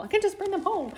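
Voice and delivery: excited. Retold or imitated. Retelling own excited wish.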